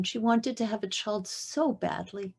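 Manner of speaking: ordinary talk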